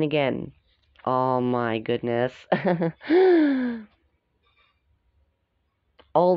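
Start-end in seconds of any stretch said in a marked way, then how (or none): 2.5-3.8 bright high laugh. Real, not acted. Reader amused by the girls, real laugh.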